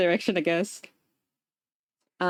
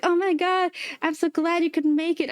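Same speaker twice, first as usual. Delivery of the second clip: high, gushing hello; excited greeting; 7 semitones higher than usual. Retold or imitated. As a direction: gush like meeting an old friend. Lady's greeting retold.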